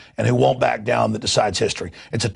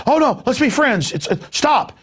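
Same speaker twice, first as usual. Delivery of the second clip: pleading German soldier; 9 semitones higher than usual. Retold or imitated. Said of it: "the German goes".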